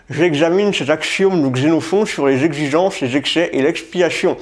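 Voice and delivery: J'examine cet axiome mushed around a pen held in teeth; slurred consonants, slow, through a pen. Shown as a demonstration. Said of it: "le stylo dans la bouche, hein, du style comme ça". (J'examine cet axiome dit avec un stylo entre les dents)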